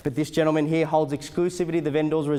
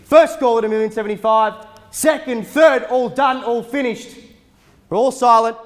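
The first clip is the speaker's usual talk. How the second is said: loud, higher going-once call; frantic trading floor shouting; 7 semitones higher, 8 dB louder than usual. Acted. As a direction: belt out the final calls. Auctioneer calling going once twice.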